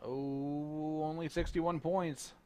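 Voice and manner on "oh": ordinary delivery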